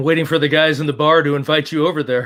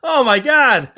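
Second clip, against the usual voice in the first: high, loud exclamation; amused horror; 10 semitones higher, 6 dB louder, slower than usual. Real, not acted. Reacting to old iced tea dispensers, real amused horror. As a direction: cry out loudly in amused disgust.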